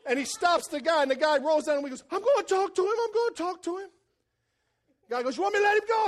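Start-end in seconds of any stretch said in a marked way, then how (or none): 2.1-3.9 pleading trespasser. Retold or imitated. 5.4-6.1 calling out. Retold or imitated. Driver calling across.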